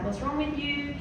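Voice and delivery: ordinary delivery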